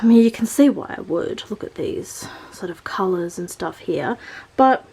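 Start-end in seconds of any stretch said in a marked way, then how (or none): 1.5-4.2 whispering. Real, not acted. Unvoiced aside.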